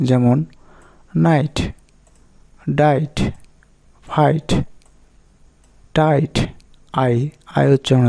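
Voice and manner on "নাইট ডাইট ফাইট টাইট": "নাইট, ডাইট, ফাইট, টাইট" with igh as a long eye sound. Shown as a demonstration. Teacher giving examples of the igh rule, "নাইট, ডাইট, ফাইট, টাইট" with an eye vowel. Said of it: "আইজিএইচ এর উচ্চারণ আই এর মতো হয়".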